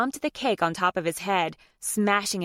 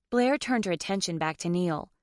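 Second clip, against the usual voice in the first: usual speaking voice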